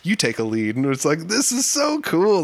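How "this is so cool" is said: high, slow, drawn-out wonder; retold awe. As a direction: stretch it out in amazement. Retold awe at campfire jam.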